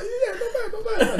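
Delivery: very high mock squeak; high breaking voice. Driver answering the aggressive stranger, voice very high and breaky. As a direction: act out a meek falsetto reply. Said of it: "it's a breaky voice, like, high".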